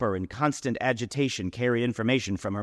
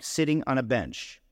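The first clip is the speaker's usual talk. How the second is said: ordinary delivery, nothing marked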